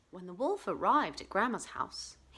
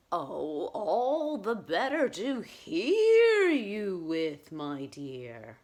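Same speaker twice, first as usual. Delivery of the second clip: wolf as Grandma; 6 dB louder, much slower than usual. Acted. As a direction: draw out the middle, drop the ending.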